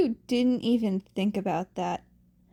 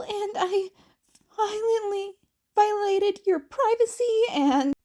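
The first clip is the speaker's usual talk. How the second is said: put-on high, fast, frantic boy's voice; caught-out teen apologising, voice cracking; panicked rambling apology; 10 semitones higher, 3 dB louder than usual. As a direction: spill the apology out in a breathless rush. Izuku caught eavesdropping, rambling apology.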